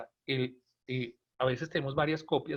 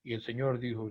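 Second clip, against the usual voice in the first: flat lifeless reading; 4 semitones lower, 5 dB quieter than usual. Shown as a demonstration. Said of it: "no es".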